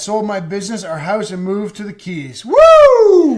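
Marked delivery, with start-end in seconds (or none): 2.5-3.3 high, loud celebratory whoop; a sudden joyful holler of celebration; excited whoop. Real, not acted. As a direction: let out a big happy woo. Celebrating moving to the Keys.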